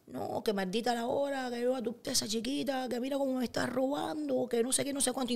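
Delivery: imitando a su madre refunfuñar para sí, más agudo y bajito; madre hablando sola. Retold or imitated. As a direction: queja murmurada de madre con Alzheimer, voz aguda y suave. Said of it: "empezó a decir". (hablando sola, quejándose en voz baja)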